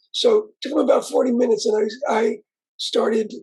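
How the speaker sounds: normal talking voice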